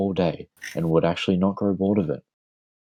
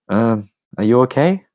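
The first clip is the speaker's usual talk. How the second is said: higher, louder attempt at a girl's voice; 5 semitones higher, 6 dB louder than usual. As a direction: put on a girl character's voice. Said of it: "I can't do the girl voice".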